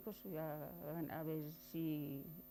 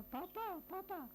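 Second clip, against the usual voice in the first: imitating children wailing papa, much higher; children crying papa; 11 semitones higher, slower than usual. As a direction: mimic the kids calling papa in a crying pitch. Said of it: "corred y echaros a llorar". (imitando a los niños que lloran «Papa», con voz mucho más aguda)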